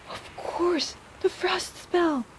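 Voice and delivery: high, slow dawning realization; eureka. Acted. Healer realizing aloud it was the frost spell. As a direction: it all clicks.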